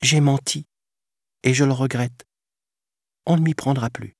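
voix de pantin aiguë et contrite; s'excuse comme un enfant sincère; petit garçon repentant. A narrator's reading. Pinocchio s'excuse. (enfant aigu qui regrette)

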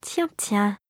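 soft, smiling musing; smiling discovery. A narrator's reading. Clara finds something interesting, softly smiling to herself. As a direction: say it quietly with a smile. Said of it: "sourit la fille".

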